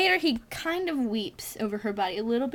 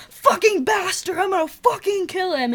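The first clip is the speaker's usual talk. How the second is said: high, slow mock rage; hammed-up angry husband vowing revenge; husband coming back to his wife, mock furious; 6 semitones higher, slower than usual. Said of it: "he's like".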